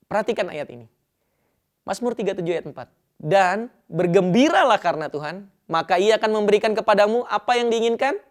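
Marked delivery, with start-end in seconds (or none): 3.1-8.2 rising to proclaim. Real, not acted. Reading a Psalm verse aloud.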